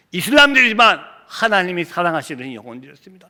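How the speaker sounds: ordinary talk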